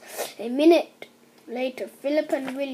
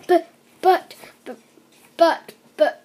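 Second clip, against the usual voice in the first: high flustered stammer, louder; a flustered wife stammering in alarm; flustered cry; 3 semitones higher, 5 dB louder than usual. A narrator's reading. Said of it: "cried Missus Gregg".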